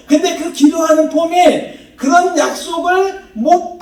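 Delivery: high, loud sermon delivery; impassioned preaching. Real, not acted. Preacher urges congregation about Elijah's prayer, impassioned high preaching.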